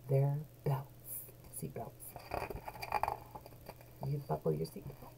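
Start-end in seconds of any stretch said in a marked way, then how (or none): none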